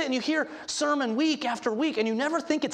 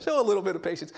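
wishful aside; 4 semitones lower, faster than usual. Pretend. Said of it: "I would like it better, I think".

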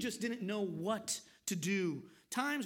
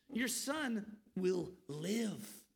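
reassuring; much slower than usual. Retold or imitated. Jesus reassures the desperate father, slow gentle promise. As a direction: let each word settle like comfort.

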